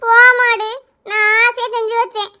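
whining retort through acted tears; sulky, drawn-out mock crying; tearful protest. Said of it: "அழாத லட்சுமி". (அழுதுகொண்டே சிணுங்கல்)

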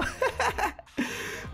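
giggle. Real, not acted. Laughs at photo.

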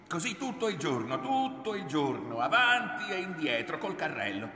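loud, high, heated grumbling; exasperated complaint. A narrator's reading. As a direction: hammer out the drudgery of it. Son vents about the menial work.

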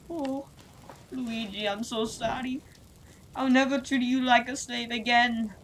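high, earnest apology; high pleading Mario voice. Acted. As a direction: plead for forgiveness.

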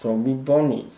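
talking through a mouthful of chocolate; words mushed by a full mouth. Real, not acted. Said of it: "Chocolate is melting".

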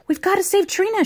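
high urgent cry without real volume; mock shout. A narrator's reading. Will rallies children to save Trina, mock shout. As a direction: call out high, in mild alarm. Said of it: "shouted Will".

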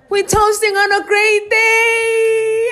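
loud, high celebratory shout; cheering at the top of your voice; excited shout. Real, not acted. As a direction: yell it out in joy.

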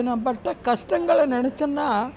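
slow, crying voice that fades at the end; real tearful lament. Real, not acted. Mother recalling the hardship of raising children, crying. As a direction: drag the words out through tears. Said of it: "அழுவாச்சு வராத இருக்காது".

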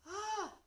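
high-pitched acted gasp; shocked gasp. A narrator's reading. Teacher spotting a dragon in class, gasping. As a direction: gasp aloud in alarm. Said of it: "gasped Miss Perks".

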